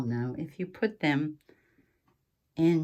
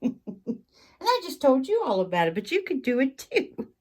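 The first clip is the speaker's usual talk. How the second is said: laughing through the words, pitched higher; amused, higher laughing voice; 8 semitones higher, 4 dB louder than usual. Real, not acted. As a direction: giggle while confessing the secret. Laughs at giving away her secret.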